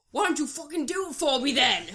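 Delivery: badly done Irish accent. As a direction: imitate a pestering Irishman, amused. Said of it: "Irish accent".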